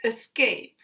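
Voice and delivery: "Escape" opening on a weak uh, then strong SCAPE; audible schwa. Shown as a demonstration. Teacher letting learners hear the schwa, "escape" as uh-SCAPE. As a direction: begin "escape" with a reduced schwa, stress the second syllable. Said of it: "you can hear the schwa here".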